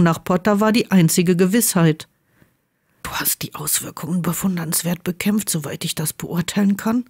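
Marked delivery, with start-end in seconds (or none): 3.0-7.0 much softer, hushed and close; hushed whisper to Draco. A narrator's reading. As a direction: say it barely above a breath. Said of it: "flüsterte Potter".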